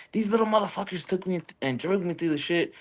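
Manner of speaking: retelling grandpa's complaint, lightly acted; mild mock old man voice; lightly voiced grandpa. Grandpa's voice retold.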